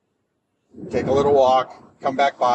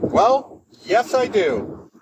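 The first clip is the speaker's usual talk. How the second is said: higher, drawn-out 'yes, I do'; retold pointed reply; 7 semitones higher, much slower than usual. Employee answering the boss's joking offer, pointed and drawn out. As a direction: stretch it out slowly, pitched up, making a point.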